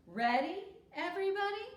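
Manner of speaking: rally the room; storyteller rallying her classmates; lively rallying call. A narrator's reading.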